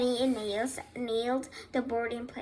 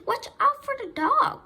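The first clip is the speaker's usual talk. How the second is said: very high, loud cry; high-pitched sheep's warning shout; cried warning; 13 semitones higher, 5 dB louder than usual. A narrator's reading. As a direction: cry out in alarm. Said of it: "cried the sheep".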